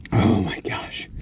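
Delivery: breathless whispered awe; stunned whisper. Real, not acted. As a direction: whisper it, lost for words. Stunned whisper over the fish.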